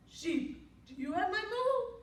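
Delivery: high, loud rising question; cow asking. A narrator's reading. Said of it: "she asked the sheep".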